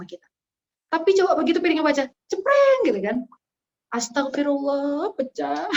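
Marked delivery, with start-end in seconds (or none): 2.3-3.2 crash sound. Retold or imitated. Plate breaking sound. 3.9-5.7 slow, high drawn-out astagfirullah; a startled but gentle astagfirullah; mock gasp. Calm reaction to broken plate.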